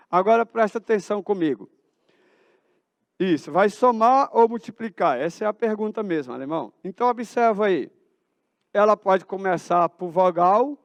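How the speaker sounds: ordinary talk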